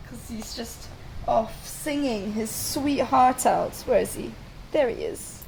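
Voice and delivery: ordinary delivery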